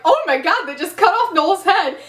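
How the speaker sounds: laughing imitation of disbelief; mock shock. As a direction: replay your shock with amusement. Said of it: "at first I was shocked".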